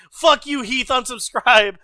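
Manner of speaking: normal talking voice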